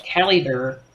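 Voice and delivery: Caliber said firmly, KAL-ih-ber; careful pronunciation. Real, not acted. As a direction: land Caliber cleanly, stress on KAL. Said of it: "I have heard the word, but apparently I've never seen the word in print".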